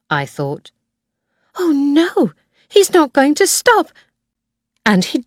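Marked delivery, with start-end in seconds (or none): 1.6-3.9 rising panic, pitched higher and louder; alarmed. A narrator's reading. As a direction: blurt it as the car keeps coming. Near crash with Land Rover.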